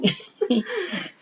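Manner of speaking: a short high burst of laughter; giggling at her answer; high giggle. Real, not acted. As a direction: laugh at the Hooters line. Laughs at partner's remark.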